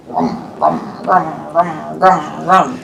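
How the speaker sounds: very low, soft chewing grums; hippo chewing. Pretend. Hippo chewing gum sound.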